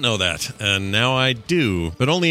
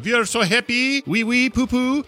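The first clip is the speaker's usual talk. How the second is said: mock happy performer; 13 semitones higher than usual. Telling what he did up on stage, high mocking imitation. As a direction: imitate him in a high silly sing-out. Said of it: "What's his name? Going".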